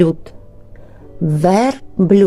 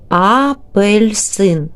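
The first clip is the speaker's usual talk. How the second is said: slow, a touch higher; slow teacher demo; 4 semitones higher, much slower than usual. Shown as a demonstration. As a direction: stretch "Апельсин", opening sound clear. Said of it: "Який звук?".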